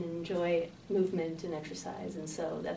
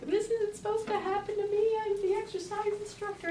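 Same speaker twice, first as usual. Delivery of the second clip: much higher, imitating a tearful whine; mock crying wail; 13 semitones higher than usual. Stuck on the floor with her injured back, a mock tearful wail, much higher. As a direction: wail it out like a self-pitying cry, much higher. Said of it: "of course I'm crying".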